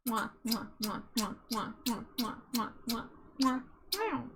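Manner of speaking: playful smooching kisses; kiss sounds. Real, not acted. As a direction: blow a quick string of kisses. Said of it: "Wife wants to be smooched eleven times".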